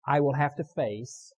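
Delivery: loud, firm line to be repeated; leading a recitation. Shown as a demonstration. Preacher asking the congregation to repeat with him, firm loud lead. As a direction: call it out for the crowd to echo. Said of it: "And then repeat with me".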